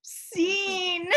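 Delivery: high, drawn-out call; sing-song sign-off on one long high word; calling scene. Pretend. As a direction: announce the end with a theatrical flourish. Calls end of the improvised scene.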